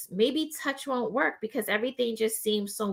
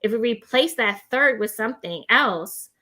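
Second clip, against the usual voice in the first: ordinary talk, nothing marked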